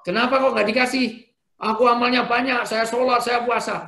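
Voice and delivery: high, loud protesting plea; complaining soul. Acted. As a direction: whine that you deserve reward. Voices complaining soul in heaven. (jiwa yang protes dengan keras)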